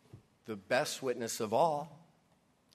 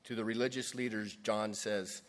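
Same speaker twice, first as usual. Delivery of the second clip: usual speaking voice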